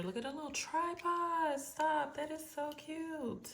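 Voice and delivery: high-pitched gush of delight. Real, not acted. Unboxer spotting the little tripod, delighted gushing. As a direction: gush up high over something adorable.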